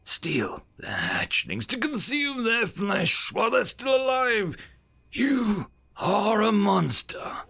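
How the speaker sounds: outraged condemnation. Acted. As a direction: spell out the horror and condemn him. Ambassador denouncing envoy.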